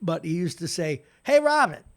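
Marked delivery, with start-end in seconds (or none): 1.2-1.8 loud, high Brooklyn coach calling out; Brooklyn accent. Retold or imitated. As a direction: bark the name in a Brooklyn accent. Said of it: "he had a real Brooklyn accent".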